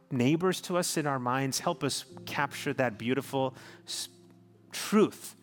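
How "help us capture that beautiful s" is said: ordinary delivery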